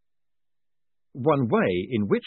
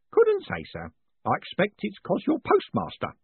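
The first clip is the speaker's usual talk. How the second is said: put-on high, fussy old postman, proud of his takings; an eager old clerk showing off coins; old man proudly reporting to his boss; proud old Grote; 10 semitones higher than usual. A narrator's reading. Grote answers.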